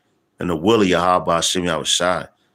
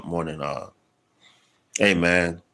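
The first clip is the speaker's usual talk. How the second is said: normal talking voice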